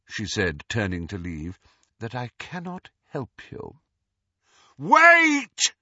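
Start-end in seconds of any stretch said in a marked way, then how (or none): 2.0-3.8 soft, dismissive refusal; quiet and aloof; cold and quiet. A narrator's reading. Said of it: "A cold smile curved her lips". 4.8-5.7 sudden shout, much higher. A narrator's reading. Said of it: "He had not meant to shout".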